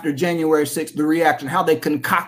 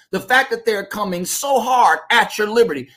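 ordinary delivery

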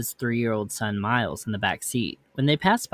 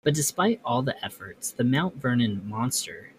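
plain reading voice

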